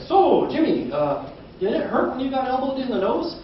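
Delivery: high, bright mock reporter asking kids; mock eager reporter. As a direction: play the earnest reporter with a dumb question.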